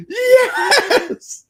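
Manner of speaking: high, loud cheer of joy; a shrieking, laughing whoop; excited yes. Retold or imitated. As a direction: burst out like a fan in the theater. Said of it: "I got animated".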